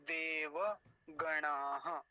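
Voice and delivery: देवगणाः very slow, va given its own vowel; full final va. Shown as a demonstration. Teacher repeating the third word, full drawn-out syllables. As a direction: draw out देवगणाः to the closing ha. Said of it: "इसको देव ऐसा बोलेंगे".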